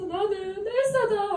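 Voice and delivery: high, slow, drawn-out mock wail; hammed-up tearful whine; mock crying. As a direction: imitate a caller sobbing out a confession. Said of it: "하면서 막 우는 거예요".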